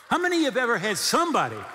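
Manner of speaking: usual speaking voice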